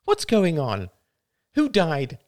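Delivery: high, loud sing-song call. A narrator's reading. Ethel interrupting from the screen door, sing-song. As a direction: trill it out in a high, carrying lilt. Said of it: "spoke in a sing-song voice".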